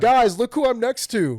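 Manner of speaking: high, loud imitation of an excited fan; hammed-up selfie-video boast; mock excited bragging. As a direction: show off to your phone audience. Mimics fan showing off on phone.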